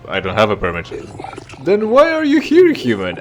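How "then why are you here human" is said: much higher, louder mock alien merchant; snooty merchant voice. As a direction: squeak it out like a haughty vendor. Voicing the snooty NPC.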